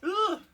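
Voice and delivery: loud high groan of dismay; frustrated groan. Real, not acted. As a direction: groan at the bad roll. Rolled a natural one.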